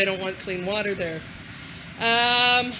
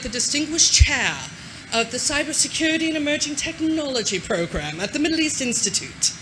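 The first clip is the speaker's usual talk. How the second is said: mock-posh reading of a grand title; lightly hammed posh accent; 4 semitones higher than usual. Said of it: "I always have to say with the posh accent".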